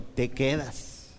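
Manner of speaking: slow, measured mock-mom order; stern mom. Mom returns to the tantrum, slow and stern. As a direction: lay it down slowly, word by word. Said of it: "se regresó mi mamá y me dijo".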